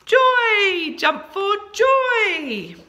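high, loud, drawn-out celebration; joyful cheer. Shown as a demonstration. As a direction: cheer it out for the class. Teacher celebrates the blended word.

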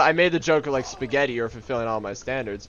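ordinary talk, nothing marked

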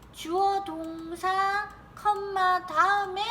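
slow, high-pitched, stretched syllables; drawn-out dictation. Real, not acted. Teacher writing out sentence structure for students, drawn-out dictation. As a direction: spell out the structure slowly as you write. Saying words while writing them out.

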